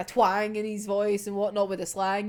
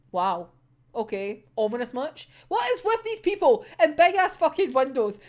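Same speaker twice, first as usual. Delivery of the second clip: ordinary delivery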